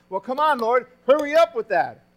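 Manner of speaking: impatient whine at the Lord; preacher playing an impatient believer, loud and mock-exasperated; mock impatience.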